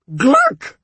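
high, loud scandalised shout of his name; aghast shout. A narrator's reading. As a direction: cut him off mid-rude-word. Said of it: "the witch shouted, aghast".

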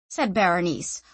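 ordinary delivery, nothing marked

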